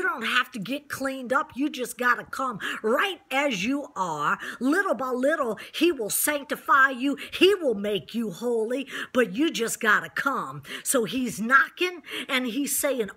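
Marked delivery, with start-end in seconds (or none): none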